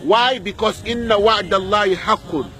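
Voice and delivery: usual speaking voice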